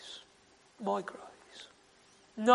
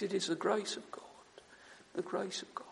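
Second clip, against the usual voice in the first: whispered, a little quick; reverent whisper; 3 semitones lower, 10 dB quieter, faster than usual. Acted. Preacher telling friends of grace, whispered. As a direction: hush it to a breath.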